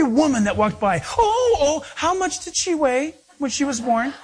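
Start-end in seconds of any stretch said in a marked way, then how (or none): 1.2-4.1 put-on high gushing coo over a baby; high cooing, breaking into a laugh. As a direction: gush like a doting woman.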